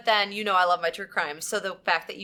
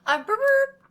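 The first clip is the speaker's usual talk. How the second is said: mock burp; 6 dB louder, slower than usual. Mock burp, level check.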